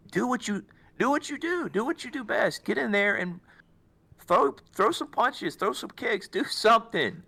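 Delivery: high, fired-up coaching; pep-talk coach. Retold or imitated. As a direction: pump up your fighter from the corner. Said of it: "want somebody to tell you".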